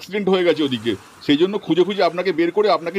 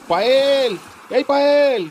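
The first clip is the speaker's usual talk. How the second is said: calling out; 6 semitones higher, slower than usual. Acted. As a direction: shout her name searching for her. Husband searching for missing wife. (উঁচু গলায় পায়েলকে ডাকা)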